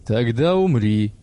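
slow, heavy, menacing; cold death threat. A narrator's reading. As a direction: pronounce it like a sentence. Father threatens with pistol.

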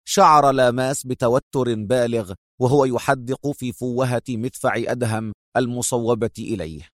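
ordinary talk

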